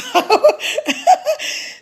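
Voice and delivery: high burst of laughter; a real giggle. Real, not acted. Laughing at own story.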